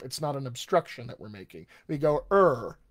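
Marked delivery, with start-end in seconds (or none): 2.3-2.8 "er" held as an open vowel shape, with no tongue contact; American R as vowel. Shown as a demonstration. Voice coach shows the American R as a vowel: open "er". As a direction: let "er" ring as a pure vowel, nothing blocking it. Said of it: "just a shape".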